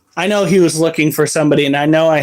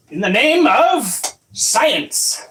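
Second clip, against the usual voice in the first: slow, higher grand proclamation; mock dramatic; 7 semitones higher, slower than usual. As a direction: announce it like a mad scientist. Mock grand line before handling.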